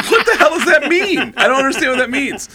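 high, loud bewilderment; acted-out exasperated yell; baffled outburst. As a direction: blurt out total confusion, loudly. Acts out baffled friend's outburst.

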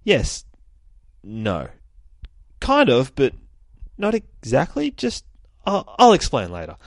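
high, flustered back-and-forth; flustered hero. Retold or imitated. Hero dodging the kid's question, high and flustered. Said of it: "And he's just like".

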